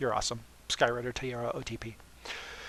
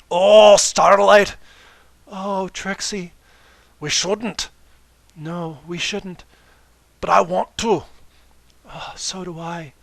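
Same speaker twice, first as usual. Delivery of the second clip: higher, slow swooning romance-novel voices; melodramatic lovers; 6 semitones higher, 11 dB louder, slower than usual. Acted. Reader parodying a steamy romance scene, swooning. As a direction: ham up the forbidden love.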